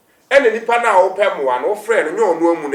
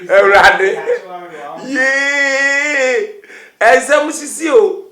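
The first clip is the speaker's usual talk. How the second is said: amazed; 5 semitones higher, 4 dB louder, much slower than usual. Real, not acted. Exclaiming in disbelief.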